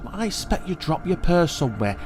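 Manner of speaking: calming aunt. A narrator's reading. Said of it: "said Aunt May".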